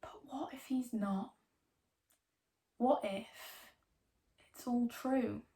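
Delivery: breathy unvoiced whisper. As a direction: whisper it like a secret. Eerie musing turns hushed.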